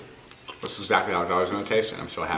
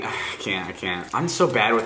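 normal talking voice

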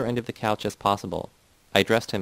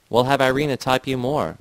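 shouted out, louder; shouting; 4 semitones higher, 5 dB louder than usual. A narrator's reading. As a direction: shout it back at him. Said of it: "Vera shouted".

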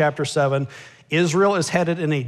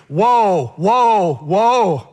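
loud proclaimed woe, each word hammered; booming woes; 3 semitones higher, 6 dB louder, much slower than usual. Acted. Preacher booming God's woe over complacent Israel. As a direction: thunder out the woes like a prophet.

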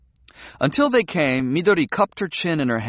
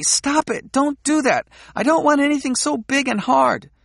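mock protest; 12 semitones higher than usual. Midori acting out protest.

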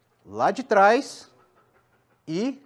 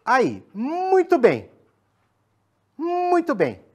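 high, drawn-out praise; praise the dog; 8 semitones higher, 6 dB louder, much slower than usual. Real, not acted. Trainer praising the dog after it obeys. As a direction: reward the dog with a bright high voice.

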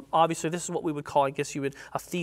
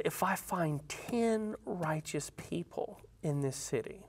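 hushed answer; 8 dB quieter, slower than usual. Acted. The Lord's calm answer.

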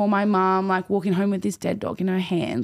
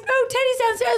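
much higher mock voice; mum's reply. Mum insisting the dog is home, high mock voice. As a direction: chirp it back like a clueless mum. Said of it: "And he's like".

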